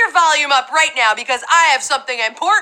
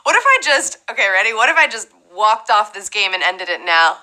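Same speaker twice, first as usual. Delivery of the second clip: ordinary talk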